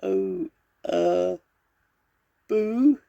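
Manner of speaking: tiny, very high-pitched lion squeak; falter, then squeak out a feeble boo; timid squeak. A narrator's reading. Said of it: "he squeaked".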